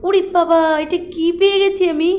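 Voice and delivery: high, loud yelp of delight at the find; excited. Real, not acted. Gamer thrilled to find a gun, loud high yelp. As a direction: burst out with excited surprise.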